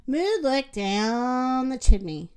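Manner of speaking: plain reading voice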